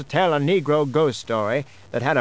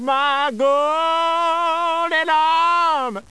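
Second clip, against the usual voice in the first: very slow, high drawn-out ghost moan; ghostly wail; 10 semitones higher, much slower than usual. Acted. Dead wife's ghost moaning through the wind, very slow and high. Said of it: "he hear the voice again".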